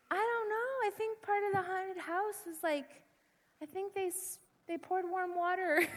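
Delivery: high, soft little voice; feigned innocence. Retold or imitated. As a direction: play innocent, small and sheepish. Feigns ignorance after wetting herself.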